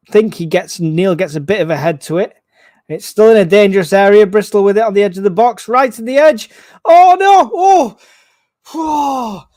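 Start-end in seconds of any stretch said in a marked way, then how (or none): 2.9-6.5 louder and higher, building as the ball nears goal; calling the danger with mounting alarm; excited rising commentary. Real, not acted. Danger near own goal. 6.8-9.5 loud high cries of alarm, fading off; real panic as the ball nears the net; alarmed cry. Real, not acted. Near own goal, real alarm.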